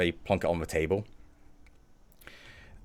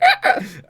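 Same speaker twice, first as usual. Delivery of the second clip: high, loud squeal of pain; short, piercing cartoonish goblin cries; goblin's pained yelp; 13 semitones higher, 11 dB louder than usual. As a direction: yelp as if your hand is crushed. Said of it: "as the goblin's hand gets crushed".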